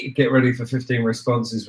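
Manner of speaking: usual speaking voice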